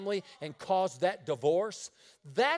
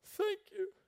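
tearful thanks; 13 semitones higher, 5 dB quieter than usual. Retold or imitated. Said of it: "he said".

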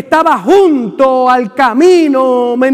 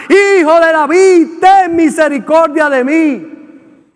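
crying out louder; 3 semitones higher, slower than usual. Acted. Bartimeo cries out to Jesus despite the crowd, louder and slow. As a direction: shout past those who hush you. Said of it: "pero él clamaba mucho más".

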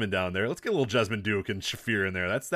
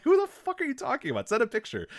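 high, incredulous mock outrage; retold exasperated reply, pitched high; exasperated disbelief; 14 semitones higher than usual. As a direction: throw your hands up at the friend.

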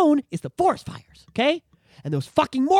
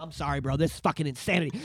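sudden calm; 9 semitones lower, 7 dB quieter than usual. Real, not acted. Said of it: "I'm gonna do better and be quieter".

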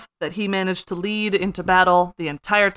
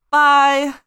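high, loud breezy farewell; breezy goodbye; 6 semitones higher, 6 dB louder than usual. Retold or imitated. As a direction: toss off a cheeky goodbye. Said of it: "and Theoden's like".

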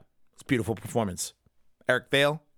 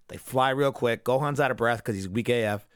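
ordinary talk

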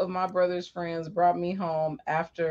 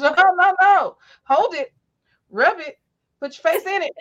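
high, loud, taunting mother; mock the sick kid like a rude mom; 9 semitones higher, 4 dB louder than usual. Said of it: "standing over me talking shit".

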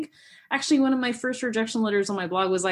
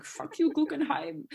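put-on higher, lightly German-accented fuck you; a half-hearted German accent, pitched up; sad German impression; 8 semitones higher, 4 dB quieter than usual. German sound artist cursing the Guggenheim, mock German accent. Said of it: "this is my sad impression of a German guy saying".

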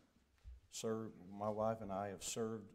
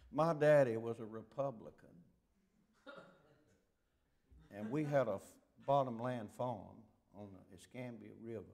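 southern accent. Shown as a demonstration. Preacher showing the congregation his mild southern accent. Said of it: "there's the southern accent".

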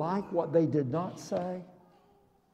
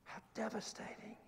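hushed breathless whisper; 22 dB quieter, faster than usual. Real, not acted. Preacher to congregation, whispered in sorrow.

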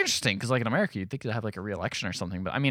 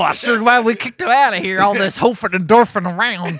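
dumb American yokel; 9 semitones higher, 8 dB louder than usual. Pretend. Bragging about the tea in the ocean, mock yokel voice. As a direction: boast like a hammed-up dim patriot.

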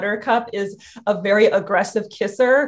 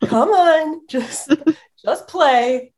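higher, pleading pet voice; voicing the dog; 6 semitones higher, much slower than usual. Dog asks owner to play, in a higher pleading voice. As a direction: beg like a dog wanting to play.

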